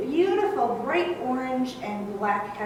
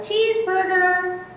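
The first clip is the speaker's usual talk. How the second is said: high, loud chirped "Cheeseburger"; high birdcall "Cheeseburger" for children; chickadee call imitation; 8 semitones higher, 5 dB louder than usual. Shown as a demonstration. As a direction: sing "Cheeseburger" like a chickadee's spring song. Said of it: "he also says".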